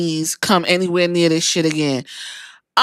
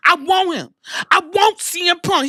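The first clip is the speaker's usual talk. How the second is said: shouting; 8 semitones higher than usual. Retold or imitated. Seth demanding a fight.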